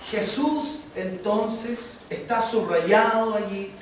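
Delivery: ordinary talk, nothing marked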